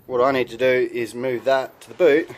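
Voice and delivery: ordinary talk, nothing marked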